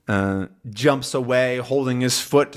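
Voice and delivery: ordinary talk, nothing marked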